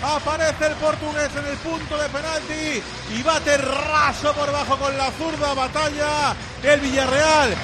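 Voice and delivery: excited radio commentary; live play-by-play. Real, not acted. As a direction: narrate the replay with energy. (narración radiofónica del gol)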